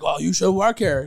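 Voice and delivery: high, loud acted urging; hammed-up nudging; mock eager suggestion. Acted couple's exchange in a story.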